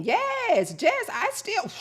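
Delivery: high, loud squeal of recognition; excited agreement. Real, not acted. Streamer agrees with Jess's comment, excited high squeal.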